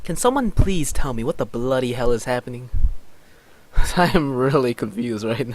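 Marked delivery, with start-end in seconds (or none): 0.0-2.7 fast and louder, in one run; quick exasperated question. Acted. Confused player asking what is happening, quick and loud. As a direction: fire off the whole question without pausing. Delivers the composed line in full.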